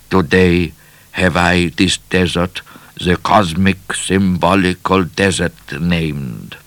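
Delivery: put-on measured foreign-accented diary reading; foreign explorer. A narrator's reading. Explorer's diary entry, measured accented voice.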